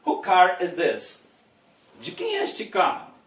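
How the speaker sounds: normal talking voice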